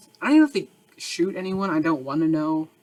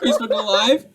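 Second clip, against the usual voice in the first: gleeful punchline; 6 semitones higher, 6 dB louder than usual. Pretend. Punchline repeated louder.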